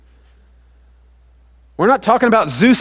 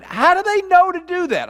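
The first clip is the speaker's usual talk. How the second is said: amazed wonder; 10 semitones higher than usual. Real, not acted. Marvelling at eagles.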